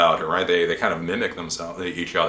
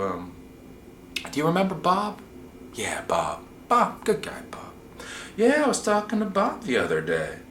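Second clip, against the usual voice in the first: higher, halting, casual probing; 8 semitones higher than usual. Acted. Old guy bringing up what Bob said, high and halting. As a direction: meander gently with pauses, dropping to a hush on the name. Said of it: "they'll just start talking s-, slow".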